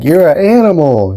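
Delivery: a hammed-up awed fan; imitating Oliver. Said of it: "he's like".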